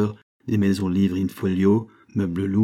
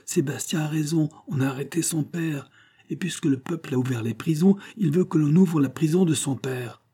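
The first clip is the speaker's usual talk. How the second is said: higher-pitched, mildly excited chorus voice; children crying out; 7 semitones higher than usual. A narrator's reading. Schoolboys pleading Sébastien's cause, crying out. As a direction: press the case like insistent children. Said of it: "crièrent les enfants".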